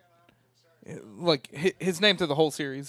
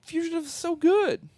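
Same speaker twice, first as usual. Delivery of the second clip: indignant; 13 semitones higher than usual. Real, not acted. Defending a favourite movie.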